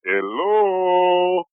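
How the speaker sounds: high, drawn-out hello; calling out. Acted. Player calls out into the graveyard, high drawn-out calling. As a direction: call into the distance, hands cupped to mouth. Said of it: "put my hands up to my mouth".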